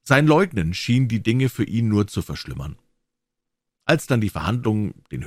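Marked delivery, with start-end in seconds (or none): none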